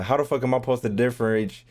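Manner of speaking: usual speaking voice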